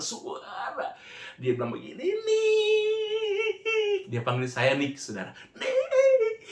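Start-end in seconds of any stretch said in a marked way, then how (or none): 2.0-4.1 high-pitched crying cry of the name; imitating a crying scream. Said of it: "Dia teriak". 5.5-6.4 high-pitched crying cry of the name; imitating a crying scream. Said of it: "Dia teriak".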